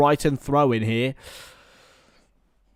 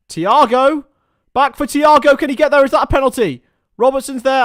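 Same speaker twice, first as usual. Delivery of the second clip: rising excited yell, high and loud; real thrill as the attack goes close; excited commentary; 11 semitones higher, 11 dB louder than usual. Real, not acted. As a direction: call the chance as it builds. A near-penalty chance in the box.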